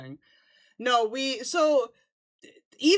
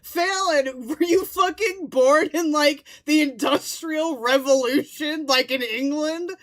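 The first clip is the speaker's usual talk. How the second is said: loud, high, laughing outrage; yelling in disbelief; 3 semitones higher, 5 dB louder than usual. Real, not acted. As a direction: shout it between laughs. Teasing Fallon, yelling, laughing.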